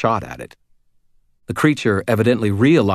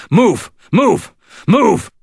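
loud, high, repeated shouts; urgent shouted command; 14 semitones higher, 9 dB louder, much slower than usual. Acted. Wizard hurrying a stranger away from the monster, urgent shouting. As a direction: yell each word as a separate order. Grabs his arm, creature coming.

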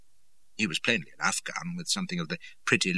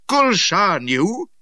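slow, high, loud accusation; strained croak; 12 semitones higher, 9 dB louder, much slower than usual. A narrator's reading. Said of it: "I croaked".

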